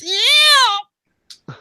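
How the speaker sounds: high, loud engine whine; imitated dying motor. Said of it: "the motor dies".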